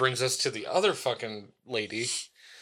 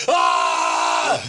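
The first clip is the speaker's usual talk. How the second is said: long, very loud yell; imitated scream; 12 semitones lower, 8 dB louder than usual. Balcony man resuming his screaming at the drivers. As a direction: go straight back into screaming. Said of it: "Goes back to screaming".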